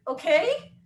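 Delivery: high rising puzzled okay; echoing the coach in disbelief; confused okay. Retold or imitated. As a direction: ask it back baffled. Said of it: "And I was like".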